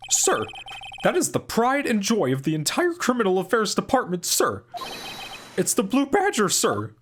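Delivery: high, earnest officer voice, slowing at the end; Officer Meekins. Acted. Officer telling the judge how he got hurt, high and earnest. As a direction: overeager young cop pleading his case. Meekins proudly presents the mascot.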